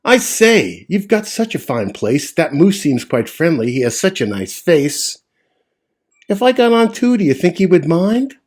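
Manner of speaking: small spider voice. Acted. Tree spider asking the bug, small high voice. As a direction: ask politely as a tiny spider. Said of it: "the small voice of a tree spider speak".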